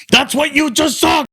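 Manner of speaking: high-pitched punchline taunt in imitation; retold stage crowing. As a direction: crow it at the crowd like a hyped comic. Retelling comic's stage opener.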